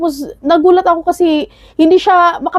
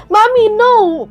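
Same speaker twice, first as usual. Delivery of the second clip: higher toddler's plea, lightly voiced; a small child calling out to stop; two-year-old begging mommy to stop, lightly voiced and higher; child pleading; 7 semitones higher, much slower than usual. Toddler stops mother with knife.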